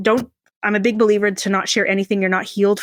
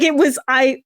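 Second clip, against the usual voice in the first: normal talking voice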